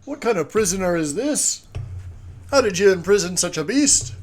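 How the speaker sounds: loud, high in-character outcry; outraged prisoner raising the voice high; indignant shout. Acted. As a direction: shout it at the captors in disbelief. Player's character challenges the captors.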